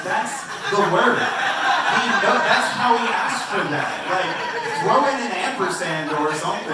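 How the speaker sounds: exasperated son laughing and shouting back at his mom. Retold or imitated. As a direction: yell it at mom through laughter, disbelieving. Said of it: "I was like".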